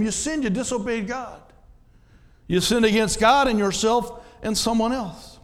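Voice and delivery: ordinary talk, nothing marked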